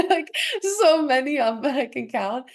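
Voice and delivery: high, loud laughing talk; words spilling through laughter. Real, not acted. Guest laughing to her mentor about her many quirks.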